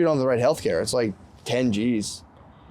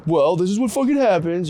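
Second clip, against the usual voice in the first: exasperated mock; 5 semitones higher, 4 dB louder than usual. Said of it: "you can't just be like".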